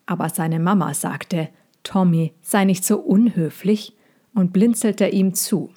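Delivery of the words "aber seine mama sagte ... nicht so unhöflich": plain reading voice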